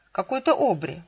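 ordinary delivery, nothing marked

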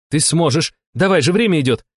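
high-pitched cheering on; urgent encouragement. A narrator's reading. Fanvizina to Shama as time runs, urgent. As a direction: spur your partner on urgently.

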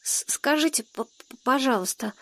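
put-on timid boy stuttering on first sounds; hesitant stammer. A narrator's reading. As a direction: stumble shyly over the polite request. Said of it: "нерешительно нарушил молчание".

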